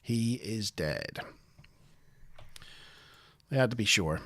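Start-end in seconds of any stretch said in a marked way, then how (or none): 0.0-1.2 solemn pronouncement. Retold or imitated. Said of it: "steps in front of the corpse and says".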